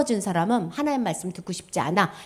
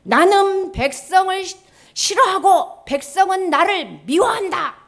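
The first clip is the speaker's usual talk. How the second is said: high, loud, slow proclamation; solemn written verdict, declaimed loudly; scripture declamation; 7 semitones higher, 6 dB louder, slower than usual. Acted. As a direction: read it out grandly, word by word. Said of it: "성경에 뭐라 써냐면".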